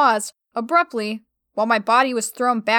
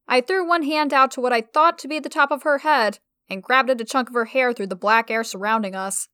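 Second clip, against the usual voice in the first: plain reading voice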